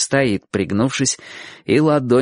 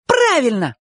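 high, jubilant squeal; joyful shout; 13 semitones higher than usual. A narrator's reading. Sonka confirms it and jumps, joyful shout. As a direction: cry it out, jumping for joy. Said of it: "крикнула Сонька".

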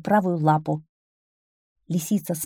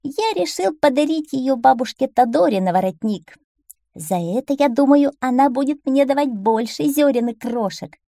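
higher-pitched little duckling voice; young duckling, boasting; 6 semitones higher, 3 dB louder than usual. A narrator's reading. Duckling telling mother his plan for the fox, high boastful voice. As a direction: explain the plan as an eager duckling.

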